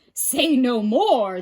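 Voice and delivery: slow loud giants' vow; crying out. A narrator's reading. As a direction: declare it heavily. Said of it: "they cried".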